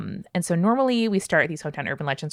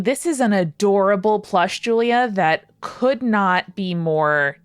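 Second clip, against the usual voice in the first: usual speaking voice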